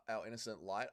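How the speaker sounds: usual speaking voice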